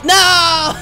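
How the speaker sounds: high, loud cry of no; dismayed shout. Real, not acted. Gamer failing to heal in time, dismayed shout. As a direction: yell it out in dismay.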